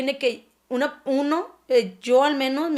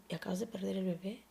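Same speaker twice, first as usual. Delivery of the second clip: softer, faster whisper; whispering the doctor's bad news; 4 semitones lower, 14 dB quieter, faster than usual.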